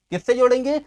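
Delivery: usual speaking voice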